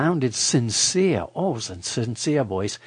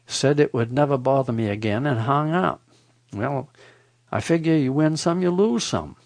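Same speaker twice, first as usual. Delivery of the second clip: ordinary delivery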